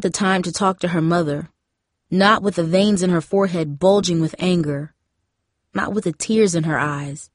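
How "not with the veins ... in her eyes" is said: usual speaking voice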